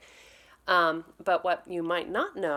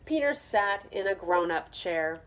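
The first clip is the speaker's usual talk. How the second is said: plain reading voice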